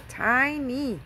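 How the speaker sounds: TINY drawn out with a high arch, then falling; TINY sung out long and high to stress it; emphatic TINY. Real, not acted. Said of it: "When I tell you tiny".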